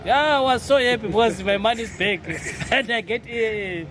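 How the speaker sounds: ordinary talk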